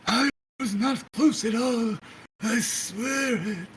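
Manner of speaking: high-pitched creepy protest; put-on creep voice, slowing at the end; Mister Creep. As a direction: plead innocence in an eerie high voice. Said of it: "You can feel mister Creep is literally".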